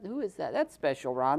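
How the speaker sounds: ordinary talk, nothing marked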